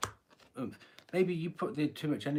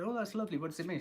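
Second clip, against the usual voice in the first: Rose, sweetly naive; 6 semitones higher, faster than usual. Retold or imitated. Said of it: "and Rose goes".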